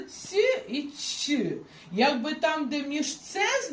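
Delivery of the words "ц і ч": ц і ч said slowly, the sound between ц and ч. Shown as a demonstration. Teacher shows the pupil a sound between ц and ч, slowly. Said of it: "читається якби між ц".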